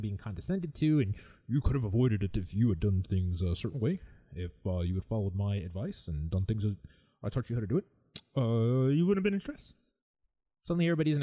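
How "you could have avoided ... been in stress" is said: lightly mocking know-it-all; condescending. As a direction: imitate a patronizing advice-giver. Voicing condescending advice-givers.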